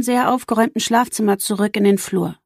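plain reading voice

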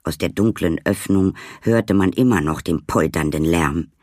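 ordinary delivery, nothing marked

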